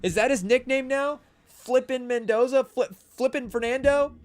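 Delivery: loud, high amused disbelief; bursting out with the new nickname; excited. Real, not acted. Streamer to chat, amused and loud.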